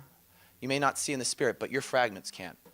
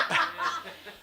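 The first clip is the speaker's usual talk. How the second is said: high-pitched burst of laughter. Real, not acted. Laughs at own story.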